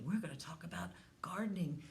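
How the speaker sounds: put-on hushed, leaning in to the kids; secret whisper. Teacher tells children the topic, whispering.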